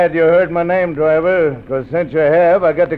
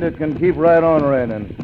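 normal talking voice

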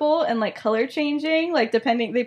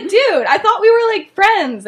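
high, loud mock-wounded protest; offended; 9 semitones higher, 9 dB louder than usual. Girl protesting to her friend, acted offended. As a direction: act hurt and indignant, pitched up. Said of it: "She's, like, so offended".

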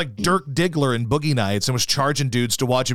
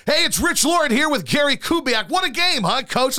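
much higher, upbeat winning-night voice; mock excited reporter; 11 semitones higher than usual. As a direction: gush like a thrilled sideline reporter. Reporter after Texans win.

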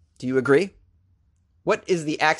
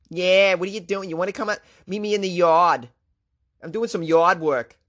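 thick, hammed-up Long Island accent; mock a Long Islander chatting about yard work; 3 semitones higher than usual. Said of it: "very unsexy accent, right? Long Island".

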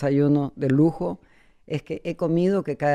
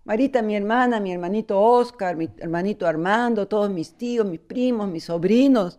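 high, calling greetings to family; Peruvian TV shoutout; 6 semitones higher than usual. Shown as a demonstration. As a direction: sing out the family names. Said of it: "Así hacen con los peruanos".

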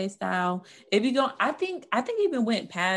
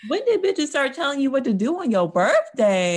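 louder, punchy rant; indignant; 5 semitones higher, 7 dB louder than usual. Real, not acted. Ranting to co-host about birthday rules, louder and indignant. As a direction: hit every word, fed up.

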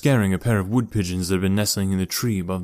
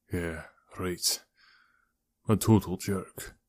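dry chuckle; 3 semitones lower, 8 dB quieter, slower than usual. A narrator's reading. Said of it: "Nat chuckled".

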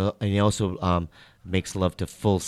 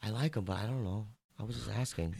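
hushed gossip voice; whispering; 12 dB quieter than usual. Real, not acted. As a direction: whisper like sharing a secret. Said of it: "Why are we whispering?".

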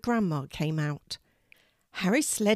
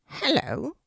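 unusual voice; 8 semitones higher than usual. A narrator's reading. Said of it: "said an unusual voice".

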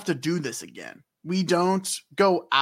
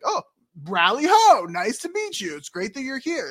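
high, hearty hello to newcomers; friendly group greeting; 6 semitones higher than usual. Acted. The whole group greets the newcomers, cheery and high. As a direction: call the greeting out high, then chat politely. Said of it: "is like".

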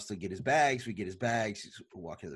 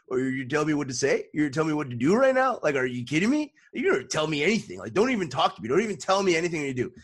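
raised, louder angry rant, higher pitch; upset Ric Flair rant; 6 semitones higher, 6 dB louder than usual. Retold or imitated. A wrestler upset at his driver, higher angry rant. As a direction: snap back in an angry, higher voice. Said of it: "Ric Flair turns to him, so upset".

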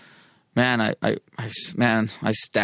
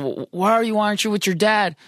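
mom's demand retold with pitch raised high; worried mom; 8 semitones higher than usual. Mom on the phone asking her son, sharply pitched. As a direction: ask like a frantic mother who can't find her son.

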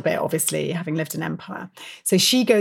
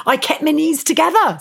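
prim boast; 8 semitones higher than usual. Retold or imitated. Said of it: "says Grace".

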